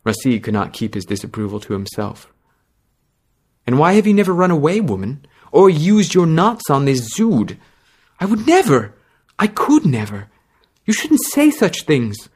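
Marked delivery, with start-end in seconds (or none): 3.7-7.6 accusing. Acted. Rashid's disapproval. 8.2-12.3 high, panicked denial; terrified. Acted. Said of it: "Ushra looked terrified".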